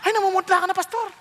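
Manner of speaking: a light, teasing imitation of a worried fan; fussing churchgoer. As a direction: church member telling the pale pastor, hammed-up high voice. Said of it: "naglalapitan pa yung mga tao".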